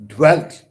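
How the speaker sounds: "Dwelt" punched high and loud; stress on DWELT. Shown as a demonstration. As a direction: hit "Dwelt" hard as the accented beat. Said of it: "I stress".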